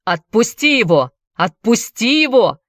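insistent repeated shout. A narrator's reading. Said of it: "повторял он снова и снова".

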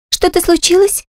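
put-on high, startled young maid voice; young maid, breathy gasp. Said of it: "выдохнула она". (испуганно выдохнула)

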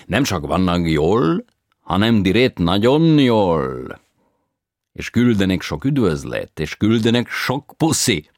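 high, loud, slow operatic foreigner; foreign tenor voice. A narrator's reading. Tenor tells grandparents news in broken Hungarian.

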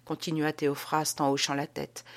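ordinary delivery, nothing marked

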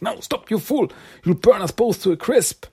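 high panicked cry; panicked protest. Retold or imitated. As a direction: squeal the protest in alarm. Krang panics near the fire.

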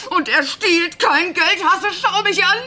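high, slow, crying voice; tearful plea. Acted. Mother defending the father to her son, high and tearful. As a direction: hold back tears as you insist.

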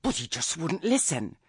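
ordinary delivery, nothing marked

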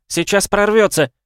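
high, loud panicked warning; shrieking. A narrator's reading. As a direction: scream the warning in terror. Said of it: "взвизгнула Нейс". (испуганный визг)

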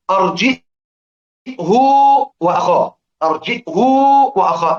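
أرجئه وأخاه recited with hamza and a rounded هاء, carefully; demo reading. Shown as a demonstration. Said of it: "ماذا فعلت؟". (قراءة أرجئه وأخاه بالهمز وضم الهاء)

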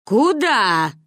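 stern, stretched call to halt; grandmother's shout. A narrator's reading. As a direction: call out sharply to stop runaways. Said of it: "крикнула Ба".

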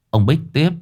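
usual speaking voice

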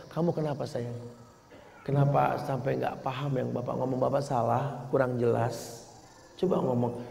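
a soft, patient teacher asking a pupil; gentle teacher. Acted. Teacher gently asks a struggling pupil, softly. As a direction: coax the child gently to explain. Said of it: "Tanya sini".

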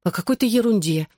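ordinary delivery, nothing marked

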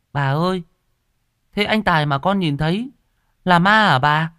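put-on high-pitched little-boy question; surprised child. A narrator's reading. Boy asks grandma if Tài is a ghost, surprised. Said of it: "Nó ngạc nhiên".

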